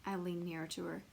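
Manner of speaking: ordinary delivery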